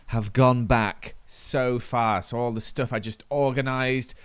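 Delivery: usual speaking voice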